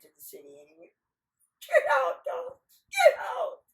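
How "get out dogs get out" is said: very high, loud yelling; hammed-up high-pitched shout; squealed command. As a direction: shriek it heedlessly at everyone. Nasrudin yells it in the city.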